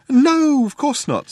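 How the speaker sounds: high, airy denial, loud first word; breezy. A narrator's reading. Zaphod denies visiting Earth to Ford, breezily. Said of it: "said Zaphod breezily".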